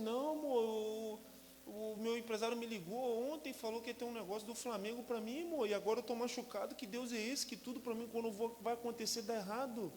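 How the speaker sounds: high-pitched anguished lament; injured player complaining to his wife about God; despairing complaint. Retold or imitated. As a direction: pour out frustration to your wife. Own despairing quote to wife.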